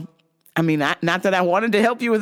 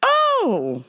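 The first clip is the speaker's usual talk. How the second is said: put-on high, slow little-old-lady voice; sweet old grandma; slower than usual. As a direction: play a tiny sweet grandma asking politely. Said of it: "She goes".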